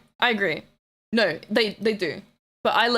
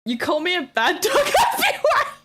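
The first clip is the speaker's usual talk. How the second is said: much higher, louder, laughing through it; laughing protest; 8 semitones higher, 4 dB louder than usual. Real, not acted. Streamer to chat, laughing protest.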